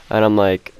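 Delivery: plain reading voice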